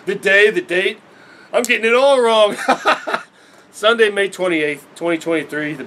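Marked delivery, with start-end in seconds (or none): 2.6-3.2 quick unvoiced giggle; a short breathy laugh. Real, not acted. Vlogger laughing at own date mix-up, breathy giggle. Laughing at getting dates wrong.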